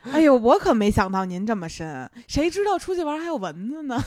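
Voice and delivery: put-on loud, sing-song Beijing accent; mock-polite Beijing accent. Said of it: "这就是北京话版".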